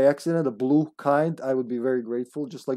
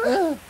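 high squeaky grunt copy; imitated grunt; 14 semitones higher than usual. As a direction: mimic Cindy's high little grunt. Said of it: "Cindy going".

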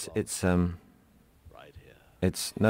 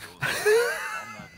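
a sudden high shrieking laugh. Real, not acted. Co-star cracks up laughing at his own Roger Moore line.

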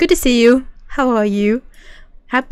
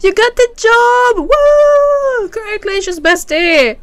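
high, drawn-out celebratory cheer; excited congratulations; 11 semitones higher, slower than usual. Real, not acted. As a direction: cheer for your best friend in a high, elated voice. Cheering friend's news of a job.

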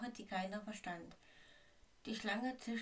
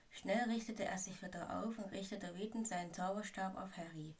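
plain reading voice

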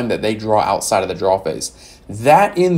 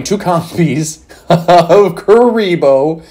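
laughing through the words, higher and louder; can't-get-over-it laughter; 6 semitones higher, 5 dB louder, much slower than usual. Real, not acted. Reading the deck list to viewers, laughing in disbelief. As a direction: stretch it out as a laugh breaks in. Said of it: "I can't get over that".

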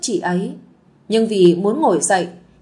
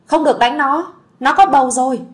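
higher, louder protective shout; desperate cry out; 4 semitones higher, 5 dB louder than usual. Acted. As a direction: throw yourself between them and cry out. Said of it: "Huyền vẫn gào lên".

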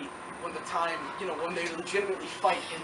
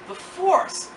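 surprised question; 9 semitones higher than usual. Retold or imitated. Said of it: "she's like".

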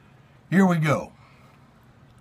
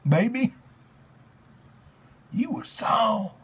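overwrought theatrical reading of lyrics; hammy stage actor; 5 semitones higher than usual. Pretend. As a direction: declaim it like a ham Shakespearean. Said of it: "Dramatic Readings".

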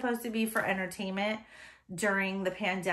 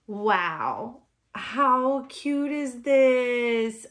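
ordinary talk

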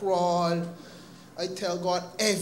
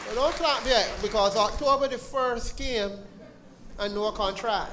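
higher, louder playful warning; don't clap yet; 5 semitones higher, 4 dB louder than usual. Real, not acted. Telling the church not to clap yet, rising and louder. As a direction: cut the applause off with a grin. Testimony punchline to congregation.

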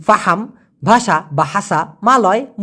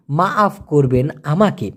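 normal talking voice

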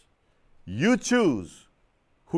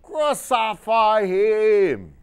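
high, very slow, drawn-out mob cry; mob shouting; 9 semitones higher, 6 dB louder, much slower than usual. Acted. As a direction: howl it like a hostile crowd. Mob crying again for crucifixion.

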